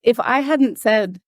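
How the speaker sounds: ordinary talk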